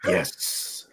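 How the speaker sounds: low, slow gravelly crime boss; measured menace with pauses; Kingpin voice. Pretend. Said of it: "a pause and then a little aggressive".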